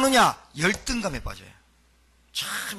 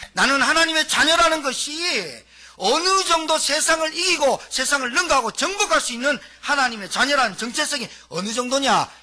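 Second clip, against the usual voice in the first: preaching at full force; 7 semitones higher, 6 dB louder than usual. Acted. Preacher proclaiming identity to the congregation, full force. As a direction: proclaim it to the whole church.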